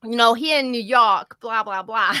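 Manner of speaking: cartoony New York accent of the guest; cartoon New York accent. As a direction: ham up a New Yorker's twang. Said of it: "sounded like a cartoon character".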